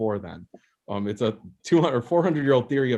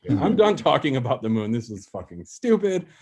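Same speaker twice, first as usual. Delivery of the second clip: ordinary delivery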